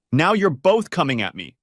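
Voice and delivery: understated shriek at both of them; mild shriek. A narrator's reading. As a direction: cry out she is cornered. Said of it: "Scylla shrieked".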